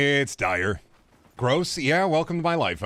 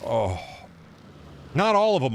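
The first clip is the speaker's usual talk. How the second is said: ordinary delivery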